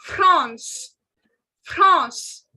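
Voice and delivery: France with a nasal French vowel, drawn out; France as 'Frahns', vowel through the nose. Shown as a demonstration. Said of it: "This has a nasal sound".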